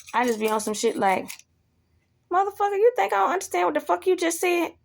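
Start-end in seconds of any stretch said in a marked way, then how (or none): 2.3-4.7 high, fast indignant challenge; hostile. Acted. Speaker answers a sarcastic remark, acted hostile and high. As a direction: rattle it off, pitched up, calling them out. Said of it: "and I get hostile".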